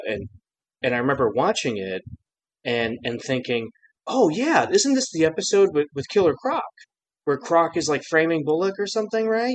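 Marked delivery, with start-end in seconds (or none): 4.1-9.5 high-pitched, eager retold thought; excited recollection. Fan recalling the episode he expected to see, excited. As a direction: act out eagerly recognizing a favorite. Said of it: "I was so excited to watch it".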